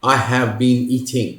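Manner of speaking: I have been eating, said very slowly; slow full form. Shown as a demonstration. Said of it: "बहुत लंबा और". (बहुत धीरे पूरा रूप)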